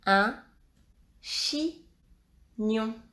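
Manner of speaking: "chignon" split in two, chi, pause, gnon; slow dictation of "chignon" with a gap inside; syllable by syllable. Shown as a demonstration. Rereading a dictated word for pupils.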